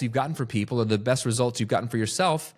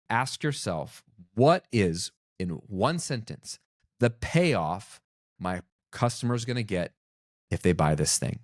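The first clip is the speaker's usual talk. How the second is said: ordinary talk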